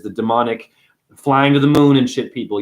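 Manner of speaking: usual speaking voice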